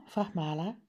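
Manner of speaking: plain reading voice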